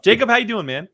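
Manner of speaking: normal talking voice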